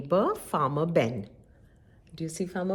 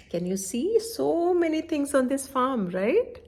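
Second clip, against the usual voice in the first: usual speaking voice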